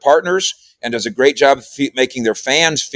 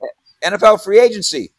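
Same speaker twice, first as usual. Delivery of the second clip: higher, hurried list of excuses; mock-frantic, higher-pitched begging off; exasperated protest; 8 semitones higher than usual. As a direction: pile up the reasons you can't go. Protesting to wife about vacation.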